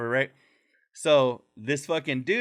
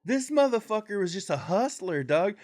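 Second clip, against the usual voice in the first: higher surprised exclaim; realization; 8 semitones higher than usual. Retold or imitated. Narrator realizing the vendor was a hustler, higher surprise. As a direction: catch on in delight. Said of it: "And I was like".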